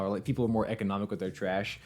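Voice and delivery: ordinary talk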